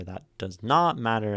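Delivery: NOT punched loud mid-phrase; stress on NOT. Shown as a demonstration. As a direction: hit NOT hard before "matter". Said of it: "emphasizing a word changes the meaning".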